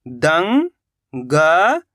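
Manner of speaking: drawn out very slowly, sound by sound; slowly stretched syllable. Shown as a demonstration. Teacher to pupils, sounding out a word very slowly. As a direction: stretch each sound for the class. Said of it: "तो मिलके बन जाएगा दंगल".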